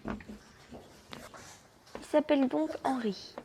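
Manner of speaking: soft, slow, breathy aside; dreamy whisper. Real, not acted. Player swooning over the new boy, slow whisper. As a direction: murmur his name like a crush.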